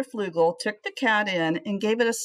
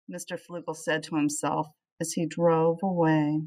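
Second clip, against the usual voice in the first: plain reading voice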